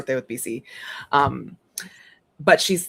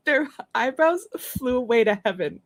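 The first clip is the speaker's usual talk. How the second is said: higher, laughing niece line; laughing through the quote; 7 semitones higher than usual. Retold or imitated. Aunt quoting her niece while cracking up. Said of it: "sorry, it's too funny".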